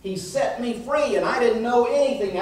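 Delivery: ordinary talk, nothing marked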